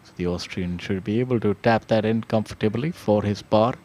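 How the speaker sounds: live golf play-by-play, conversational; golf commentator. Real, not acted. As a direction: call the putt like a TV golf commentator.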